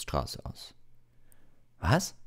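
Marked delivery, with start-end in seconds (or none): 1.8-2.2 high, louder startled shout; startled loud cry. A narrator's reading. Harry startles aloud in Lockhart's office, shouting. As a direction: jump at a sound no one else heard. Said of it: "sagte er laut".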